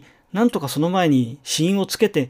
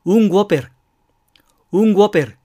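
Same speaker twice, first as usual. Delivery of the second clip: ゴペル said in Spanish style, louder, g onset; Spanish customer; 4 semitones higher, 5 dB louder, faster than usual. Retold or imitated. Stranger ordering a Whopper in Madrid, Spanish style. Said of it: "その人は".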